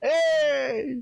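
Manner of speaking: high squealing laugh and cheer; excited cheer. Real, not acted. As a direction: whoop for a friend's crit. Cheering the crit.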